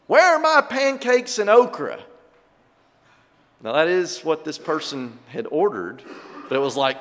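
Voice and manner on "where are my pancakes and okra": put-on a child's loud high complaint; too loud. Child complaining loudly in a restaurant, loud. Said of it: "a little too loudly".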